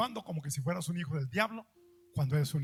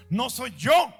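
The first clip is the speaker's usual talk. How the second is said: loud, higher outburst; sudden shout; 6 semitones higher, 11 dB louder, much slower than usual. Real, not acted. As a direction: burst out with it. Preacher bursts out mid-sermon.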